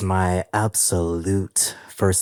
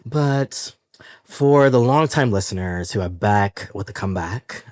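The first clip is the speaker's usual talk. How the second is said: ordinary talk, nothing marked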